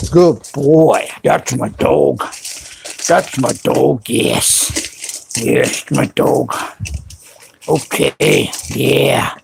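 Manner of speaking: low, soft cooing to a dog; doting pet talk. Real, not acted. Doting on the bulldog.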